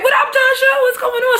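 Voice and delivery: high, loud hammed-up hello; mock cheerful greeting. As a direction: call out a fake friendly welcome. [name] greets Tasha at door.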